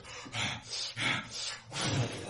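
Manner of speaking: hard, noisy puffs of breath; wind blowing. Acted. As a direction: blow out hard like a gusting wind.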